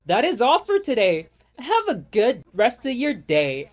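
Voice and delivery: high, loud chirpy service voice; mock the drive-thru worker's sunny script; customer service voice. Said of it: "This fool goes".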